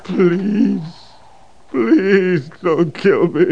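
loud, slow sarcastic begging; mock pleading. Sniper mocking the captain with slow fake begging. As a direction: pretend to beg, dripping with scorn.

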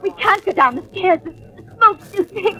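crying out in fear of the smoke; breaking into a sob as panic rises; panicked. Acted. Trapped by smoke on stairs.

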